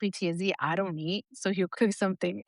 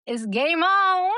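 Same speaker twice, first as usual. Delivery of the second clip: playful game on; 9 semitones higher, 5 dB louder, much slower than usual. Real, not acted. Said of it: "playful boast".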